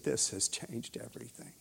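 low near-whisper, retelling her words; hushed revelation. Retold or imitated. Woman tells minister the word changed her, hushed revelation. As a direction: let the voice fall away almost to breath. Retold woman's moved conclusion.

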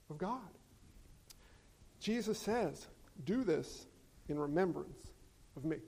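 calm the room down gently; hushed. Acted. Hushed turn after noisy beeps.

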